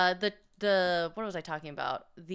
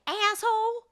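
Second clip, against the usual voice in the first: sister's shrill insult; 12 semitones higher than usual. Retold or imitated. Inside joke echoing the sister's driving insult, high squeal. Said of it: "that's an inside joke".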